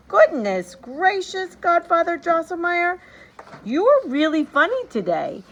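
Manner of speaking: put-on a boy cracking up at his godfather; cried out. A narrator's reading. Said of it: "cried out".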